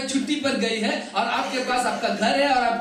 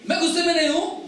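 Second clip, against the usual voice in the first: high, fast, defensive retort; angry denial; 8 semitones higher, faster than usual. Retold or imitated. Interpreter echoes husband's denial of anger, angrily. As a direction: deny it hotly as the husband. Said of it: "क्यों आप इतना गुस्से में हो".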